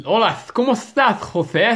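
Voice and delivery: high, loud mock Spain Spanish; lisping Spain accent. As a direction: ham up the lisped accent of Spain. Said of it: "Had a lisp".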